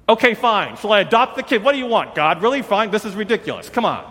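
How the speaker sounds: high-pitched, loud exasperated outburst; fed up, almost squeaking in disbelief. Retold or imitated. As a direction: throw up your hands at God. Exasperated retort to God.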